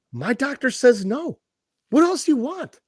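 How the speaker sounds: rising pitch, mock-exasperated retort; retold exasperation. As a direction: act out being fed up with the boss. Retold argument with his employer.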